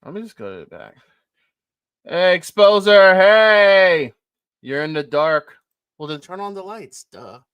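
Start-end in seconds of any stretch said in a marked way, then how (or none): 2.0-4.1 loud drawn-out call, higher. Real, not acted. Host calling his missing co-host, loud and high. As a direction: shout his name across the room.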